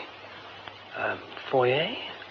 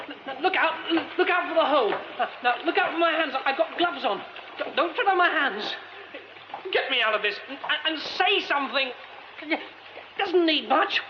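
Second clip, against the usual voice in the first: trapped man begging his rescuer to pull him out, frightened stammering; stammering plea; 10 semitones higher than usual. Acted. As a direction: beg him to pull you out, tripping over words. Pleading with stranger, stammering.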